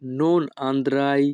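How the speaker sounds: each Italian word spoken slowly and clearly; teacher modelling Italian for learners, very slow; slow demo. Shown as a demonstration. Bengali teacher models Italian phrase for students, slow and careful. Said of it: "Italian ভাষা".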